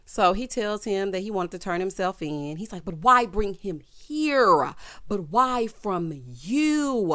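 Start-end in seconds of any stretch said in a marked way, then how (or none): 2.8-7.1 very slow, drawn-out melodrama; overdramatic. Retold or imitated. Man questioning Maurice about the visit, overdramatic. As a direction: stretch each question out with mock anguish. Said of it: "Why are you being so dramatic".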